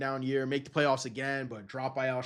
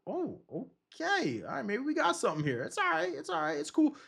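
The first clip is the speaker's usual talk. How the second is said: relieved surprise; 13 semitones higher than usual. Retold or imitated. Eagles fan relieved after the first season, high and reassuring. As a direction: sound pleasantly surprised and reassure yourself. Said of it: "we're like".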